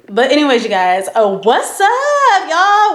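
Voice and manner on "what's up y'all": bright high-pitched hello to viewers; high excited greeting. Real, not acted. Greeting her vlog viewers with a high excited hello.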